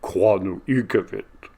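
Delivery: hoarse, scratchy repeated question; scratchy hoarse creature. A narrator's reading. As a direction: rasp the question out of the darkness. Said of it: "scratchy and hoarse".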